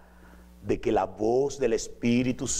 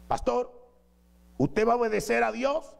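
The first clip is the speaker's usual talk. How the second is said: higher, pushy challenge; mock prophetess; 11 semitones higher than usual. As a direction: press the old pastor to obey.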